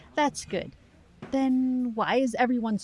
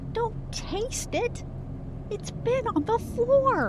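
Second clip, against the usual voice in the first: much higher, slow and drawn out in horrified alarm; alarmed warning; slower than usual. Acted. Warning a partner not to taste floor salt, alarmed. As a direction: stop him before it touches his tongue, pitched way up.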